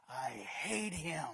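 low, slow spiteful mutter; sullen hater. Retold or imitated. Critic repeats grudge.

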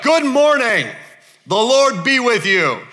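high, booming call across a field; loud, exuberant morning hail; hearty shouted greeting. Acted. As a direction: holler it out to the whole crew. Boaz hailing the workers across the field.

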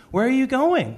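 put-on high, loud little-kid question; imitating an eager five-year-old; energetic five-year-old. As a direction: ask like an excited small boy. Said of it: "all of his five-year-old energy".

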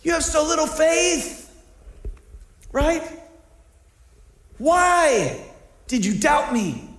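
high, exasperated rebuke of a master; lightly voiced stern Jesus scolding Peter; rebuking Jesus. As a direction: scold him like a disappointed teacher. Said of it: "He's like".

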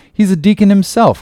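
high, loud insistence; emphatic defensive claim; insistent claim. A narrator's reading. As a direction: pitch it up as a bold boast. Ishmael insists to Bildad.

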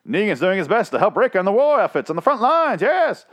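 high, loud wartime newsreel announcer parody; 1940s propaganda commercial. Acted. Mocking a war-effort advert, hammed-up 1940s announcer. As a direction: belt it out like an old propaganda ad. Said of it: "Sounds like a nineteen forties, uh, fucking, uh, propaganda commercial".

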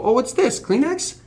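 high, squeaky, nosy monkey; curious monkey voice. Retold or imitated. Voicing monkeys finding the tissue box, squeaky and curious. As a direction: go way up like a curious critter. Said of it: "These little shits go".

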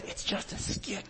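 whispered, voiceless hiss of reassurance; hushed aside. Retold or imitated. Actor in the skit to yelling villager, imitated whispered reminder. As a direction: whisper it urgently out of character. Teller shushing the hecklers.

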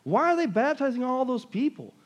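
high, excited onlooker, lightly voiced; amazed onlooker. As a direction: gasp at the crowd being baptized. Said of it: "drawing people in to say".

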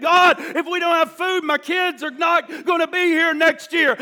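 preacher acting out a desperate prayer; desperate plea. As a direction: plead urgently to God for food. Acted desperate prayer of hungry people.

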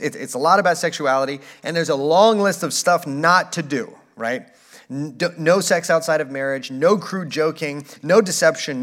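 ordinary talk

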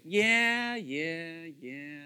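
roll-your-eyes yeah, fading away; sarcastic. Shown as a demonstration. Preacher showing a sarcastic yes, dismissive and fading. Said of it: "how about sarcastically".

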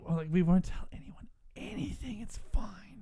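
secretive whisper to the listener. Pretend. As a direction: hush it like a secret. Whispered conspiratorial promise.

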